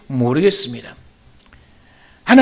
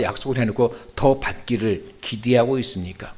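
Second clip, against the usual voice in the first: calm rebuttal; 7 semitones lower than usual. Acted. Owner calmly answers the angry workers.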